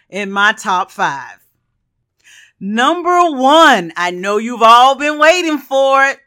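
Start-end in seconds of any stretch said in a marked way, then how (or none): 2.6-6.2 excited reveal. Real, not acted. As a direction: build up the big reveal. Big reveal of number one pick.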